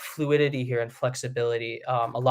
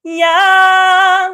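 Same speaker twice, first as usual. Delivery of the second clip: much higher, loud bright yeah; spread megaphone yeah; 15 dB louder than usual. Shown as a demonstration. Coach showing a collapsed spread sound, loud. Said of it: "It's like a megaphone".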